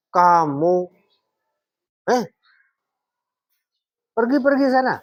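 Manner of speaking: harsh high-pitched dismissal, demonstrated; intonasi tinggi, keras. Shown as a demonstration. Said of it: "intonasinya tinggi, keras". (membentak dengan nada tinggi)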